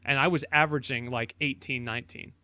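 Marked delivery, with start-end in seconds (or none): none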